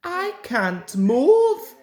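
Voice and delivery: high, loud, slow complaint; complaining. A narrator's reading. Peter Sam stuck in brambles, high slow complaint. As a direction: whine it out, stuck and helpless. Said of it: "he complained".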